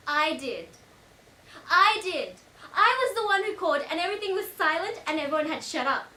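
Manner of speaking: loud, climbing in pitch; insistent protest. Acted. Witness disputing that Julia made the call, loud indignant insistence.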